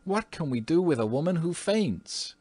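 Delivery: high plaintive complaint; exasperated cry. A narrator's reading. Wife complains to her husband about the housekeeper, high and exasperated. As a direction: throw up your hands in the question. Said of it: "cried Mrs. Otis".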